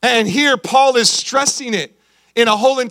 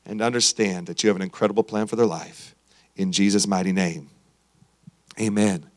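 prayer; 9 semitones lower than usual. Acted. Pastor leads prayer.

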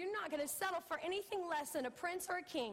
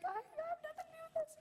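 put-on much higher, fast girlish protest; imitating daughter; 14 semitones higher, faster than usual. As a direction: squeak it out like a little girl insisting. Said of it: "She's like".

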